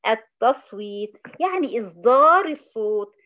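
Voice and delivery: التصويت drawn out slowly, إصدار stressed; emphatic slow. Shown as a demonstration. Teacher stressing the key word to the student. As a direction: lean on التصويت, then explain it firmly. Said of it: "ماذا؟".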